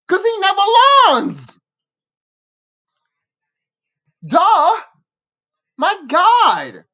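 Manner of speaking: loud, high exasperated rant at the fans; angry rant. Real, not acted. Ranting at fans who pity Cartman, loud and exasperated. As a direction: yell it, fed up with everyone.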